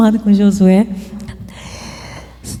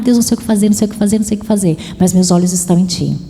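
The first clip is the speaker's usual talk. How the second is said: anxious pacing prayer; 6 semitones lower, much faster than usual. Retold or imitated.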